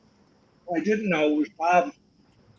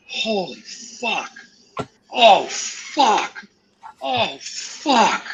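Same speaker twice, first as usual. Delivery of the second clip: higher, slowed shocked cursing, louder mid-way; stunned exclamations; 5 semitones higher, 6 dB louder, much slower than usual. Retold or imitated. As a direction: gasp it out like a man who can't believe his eyes. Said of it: "I hear this guy go".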